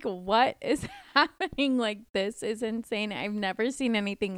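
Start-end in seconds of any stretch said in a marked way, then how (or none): 0.0-1.8 laughing through a baffled question; cracking up mid-question; giggling disbelief. Retold or imitated. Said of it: "I was like".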